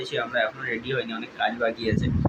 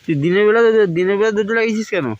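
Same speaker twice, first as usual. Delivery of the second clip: loud teasing scold; 7 semitones higher, 6 dB louder than usual. Real, not acted. Scolding friend for locked door.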